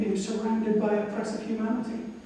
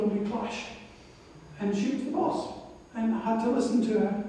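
ordinary delivery

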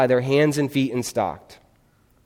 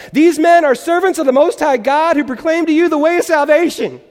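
high-pitched, loud imitation of a screaming girl; 14 semitones higher, 7 dB louder than usual. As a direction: scream it at the top of your lungs. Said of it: "she would literally scream at the top of her lungs".

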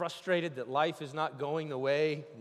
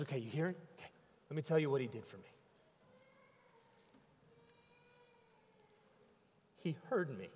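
much softer, hushed testimony; broken man's murmur; 9 dB quieter, much faster than usual. Acted. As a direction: barely voice it like a beaten man. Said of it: "And he says".

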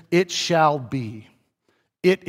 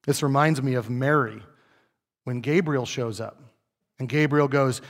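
ordinary talk

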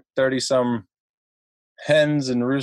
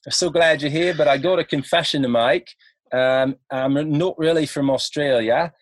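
higher-pitched fake Aussie accent; Australian accent; 3 semitones higher than usual. American student confessing to his club in a mock Australian accent. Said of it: "in my best, uh, attempt of an, an accent".

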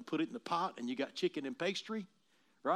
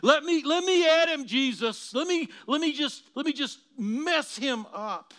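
high, loud, strange voice, pleading to attack; a sneering devil pushing to get at him; begging Satan; 9 semitones higher, 9 dB louder than usual. Acted. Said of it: "Have you considered Job?".

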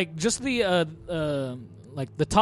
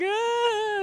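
put-on little girl squeal. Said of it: "And she's like".

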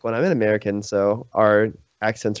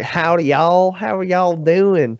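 hammed-up Southern drawl, higher and slow; 6 semitones higher, slower than usual. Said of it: "It's either like".

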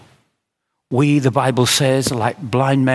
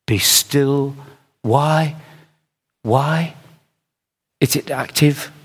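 usual speaking voice

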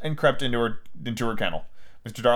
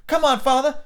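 put-on high-pitched boy, urging; urging child; 11 semitones higher than usual. Son urges father to take medicine, impatient urging.

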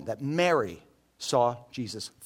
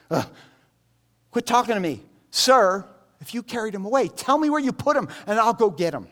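higher and louder, exasperated brush-off; can't be bothered; 7 semitones higher, 6 dB louder than usual. Retold or imitated. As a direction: swat away an annoying stranger. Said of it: "She's like, she can't even be bothered".

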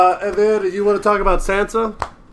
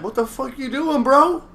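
raised pitch, incredulous challenge; mock alarmed warning; 6 semitones higher, slower than usual. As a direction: warn someone off in a retold quote. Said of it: "Jon Snow's like".

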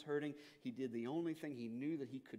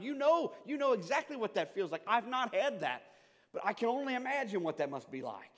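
ordinary talk

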